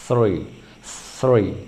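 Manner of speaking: "three" repeated slowly apart, each opening on th; teacher's model of "three"; English "three" pronunciation. Shown as a demonstration. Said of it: "kurang lebih seperti ini".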